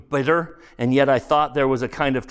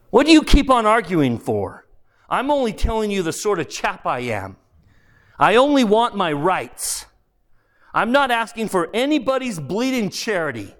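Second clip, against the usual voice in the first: higher-pitched, indignant complaining; indignant ghost; 8 semitones higher than usual. Acted. The ghost demands his rights from the spirit, indignant. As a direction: protest hard, like a man cheated.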